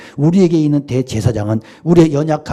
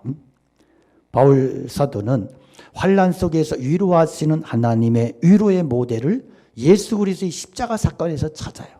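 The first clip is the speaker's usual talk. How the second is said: ordinary talk, nothing marked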